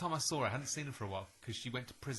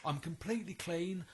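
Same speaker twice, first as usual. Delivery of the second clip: mock earnest claim; 6 semitones higher than usual. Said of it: "she goes".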